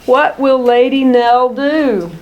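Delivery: ordinary delivery, nothing marked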